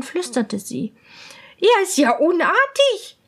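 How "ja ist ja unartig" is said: higher, louder indignant princess; offended princess. Acted. Princess hears the demand, high and indignant. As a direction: huff it out, scandalised. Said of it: "sagte die Prinzessin".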